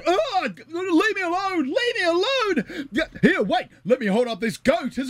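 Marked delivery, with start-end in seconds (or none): 0.1-1.6 high panicked yell; yelling for help. Acted. Fleeing slaps, crying out. 1.6-2.9 high, slow sobbing plea; crying plea. Acted.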